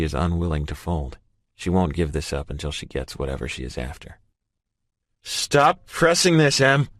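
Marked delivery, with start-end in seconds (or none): 5.2-6.9 slow loud furious bellow. A narrator's reading. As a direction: roar it to shake the windows. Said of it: "I bellow".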